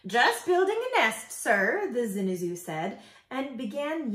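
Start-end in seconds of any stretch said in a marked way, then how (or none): 0.1-1.9 high, loud bird voice; perky bird. A narrator's reading. Zinazoo bird answers the moose, high and loud. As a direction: pipe back up brightly.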